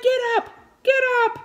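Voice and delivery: high, loud urgent hollering; loud high-pitched cheering on; yelling at the screen. Retold or imitated. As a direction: holler at someone who has to get moving. Retells yelling at movie screen.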